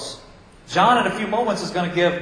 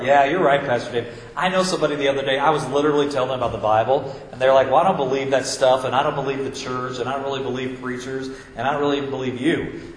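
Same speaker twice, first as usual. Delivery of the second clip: lower, quick, lightly voiced smug churchgoer; 7 semitones lower, faster than usual. Churchgoer agreeing with Pastor Dave about someone else, low and quick. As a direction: rattle it off like a pleased listener pointing at others.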